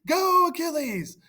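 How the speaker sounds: very high, loud crowd cheer; cheering shout. Acted. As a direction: whoop him on like a fan. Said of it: "The Greeks also shouted".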